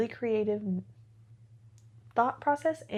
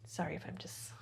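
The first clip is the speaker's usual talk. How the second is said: whisper; 3 semitones lower, 14 dB quieter than usual. Real, not acted. Apologetic aside, whispered.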